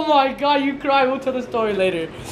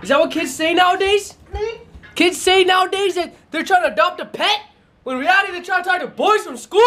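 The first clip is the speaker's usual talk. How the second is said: high-pitched, incredulous questioning; mock indignant grilling; 9 semitones higher than usual. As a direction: play the scandalized interrogator, voice pitched way up. Mock interrogation, later called a joke.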